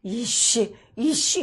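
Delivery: loud angry cursing as a man; shouted male curse; demon's gruff male voice. Retold or imitated. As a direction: bark the curse like a rough man. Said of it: "이게 남자같이 씩씩한".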